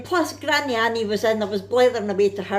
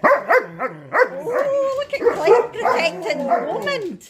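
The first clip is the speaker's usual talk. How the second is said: excited cooing; 13 semitones higher, 3 dB louder, much slower than usual. Real, not acted. Cooing at pets.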